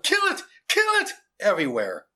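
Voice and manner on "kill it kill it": high, loud mob cries; shouts. A narrator's reading. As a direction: shout it like a frenzied hunter. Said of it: "with shouts of".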